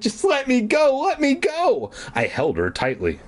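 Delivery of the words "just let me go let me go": a higher, pleading mock cry. Margaret pleading with Cooper to release her, mock frightened. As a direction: beg to be let go, pitched up. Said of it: "she cried".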